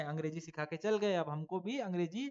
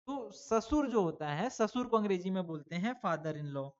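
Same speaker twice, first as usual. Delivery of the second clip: ordinary delivery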